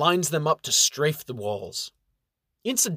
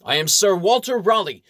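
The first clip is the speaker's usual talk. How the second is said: proud official; 6 semitones higher, 5 dB louder than usual. Acted. Official introduces himself.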